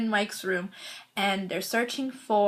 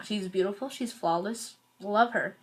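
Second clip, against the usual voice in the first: ordinary talk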